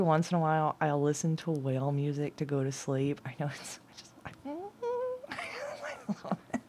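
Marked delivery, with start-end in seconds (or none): 4.3-6.6 squeaky high laugh through the words. Real, not acted. Laughing while admitting she loves whale sounds. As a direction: giggle it out, half overcome.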